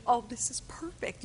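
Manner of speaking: hushed inner voice, barely voiced; whispered thought. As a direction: whisper it like a gleeful private thought. Secret delighted thought, told as aside.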